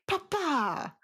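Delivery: high, exaggerated papa cry; Jared Leto's Italian voice. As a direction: call out papa, hammy. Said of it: "was like".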